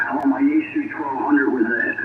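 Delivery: normal talking voice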